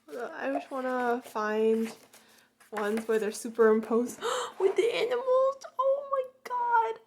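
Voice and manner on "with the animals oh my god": squealing cry. Real, not acted. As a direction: squeal in delight. Sees animal photo cards.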